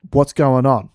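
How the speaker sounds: a loud, threatening shout. Acted. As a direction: bark it out to scare the sergeant. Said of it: "Threateningly with the axe".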